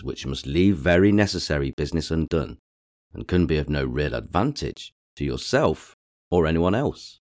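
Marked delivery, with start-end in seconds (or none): none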